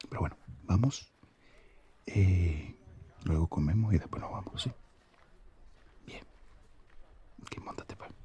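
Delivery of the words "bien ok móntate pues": soft unvoiced whisper to her. Whispering to his girlfriend to hop on the bike, whisper. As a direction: hush it, just for her ears.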